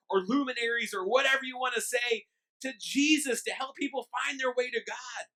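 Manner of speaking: loud, high preaching push; preaching at full force. Acted. Pastor preaching to his church, loud and high. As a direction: drive it home to the congregation.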